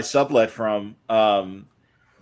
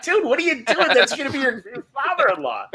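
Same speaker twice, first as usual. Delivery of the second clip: very high laughing alarm; shocked disbelief; 14 semitones higher than usual. Retold or imitated. Friend seeing the insulting screensaver, high shocked laugh. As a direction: squeal it out in horror. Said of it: "It's like".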